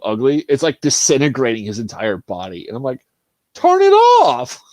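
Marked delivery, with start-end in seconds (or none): 3.5-4.6 high, loud, slow plea; exasperated yell. Retold or imitated. Viewer shouting at Palpatine, exasperated. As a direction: yell at the screen in disbelief.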